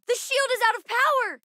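high-pitched, urgent warning; alarmed battle callout. Acted. Child warning the crew about the shield, alarmed.

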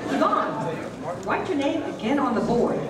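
ordinary talk

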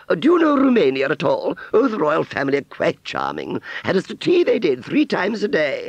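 high, loud, airs-and-graces fairground lady; Aunt Sally's grand voice. Acted. Aunt Sally boasting to Wurzel of royal friends, high snobbish voice.

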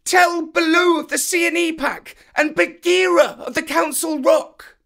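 high calling voice to the sky; shouting. A narrator's reading. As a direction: call up to the bird. Mowgli calling up to kite.

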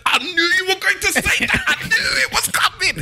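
very high, squealed imitation of his woman; woman's voice. Man mimics his woman interrupting him, high mock squeal. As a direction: put on a laughing falsetto shriek. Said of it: "she said".

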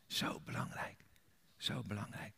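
whispered then softly repeated; quiet earnest insistence; hushed emphasis. Real, not acted. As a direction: drop to a hush on it.

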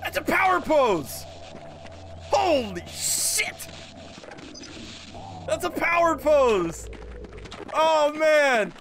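high, stretched-out exclamations; gleeful awe. Real, not acted. Streamer marvelling at the pose, high gleeful cries. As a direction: exclaim high, dragging out the words.

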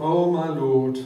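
loud pleading cry; Gideon's loud plea. Acted. Gideon pleading with God, loud and urgent.

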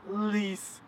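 soft warped Police; Police like rewound tape; reversed playback. Shown as a demonstration. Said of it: "That's pretty good".

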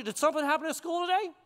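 high, quick, casual-sounding parent question; feigned-innocent parent. Retold or imitated. Parent who already heard from the teacher, feigning innocence. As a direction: pitch it up and ask as if you don't know. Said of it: "Like the teacher already told you".

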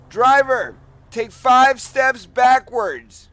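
booming police loudspeaker commands; bullhorn with siren. Real, not acted. Police stop commands over loudspeaker.

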